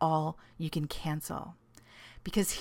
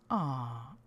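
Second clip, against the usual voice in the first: soft touched aw; low tender aw; 4 semitones lower, 5 dB quieter than usual. Retold or imitated. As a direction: melt a little at the sweetness. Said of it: "I'd be like".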